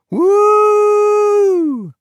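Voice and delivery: high, loud, held joyful whoop; joyful woo. A narrator's reading. Alberto rolling down the hill, high held joyful whoop. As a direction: whoop with pure glee. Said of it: "Alberto shouted joyfully".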